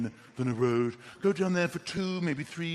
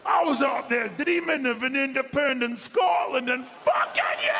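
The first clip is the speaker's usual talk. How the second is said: mental Scottish rant; 10 semitones higher, 6 dB louder than usual. Acted. Woken homeowner raging at intruder, deranged Scottish bellow. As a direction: go mental, drawing out each shout in Scots. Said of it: "Just go mental".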